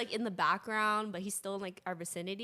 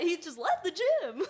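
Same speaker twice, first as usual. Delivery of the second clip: high, loud laughing; 8 semitones higher, 5 dB louder than usual. Real, not acted. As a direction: deliver the punchline laughing. Laughing punchline.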